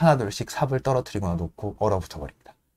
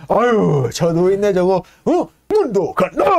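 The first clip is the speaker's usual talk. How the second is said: put-on higher, groaning old man; old Paddy complaining he can't keep up; grumbling old stoker; 6 semitones higher, 4 dB louder, slower than usual.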